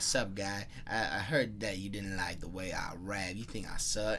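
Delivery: low, softened Southern rapper impression. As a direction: put on a low country twang like a rapper on lean. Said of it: "I'm just gonna pretend how he ta".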